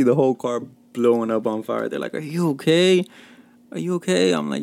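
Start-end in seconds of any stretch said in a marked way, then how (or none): 2.1-4.3 higher, anxious are you okay; worried friends. Retold or imitated. Friends checking he survived, mock worried. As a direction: fuss over him like worried friends.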